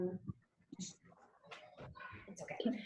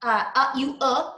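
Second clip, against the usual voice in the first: normal talking voice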